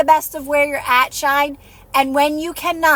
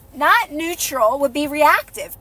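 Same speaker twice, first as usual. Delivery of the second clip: ordinary delivery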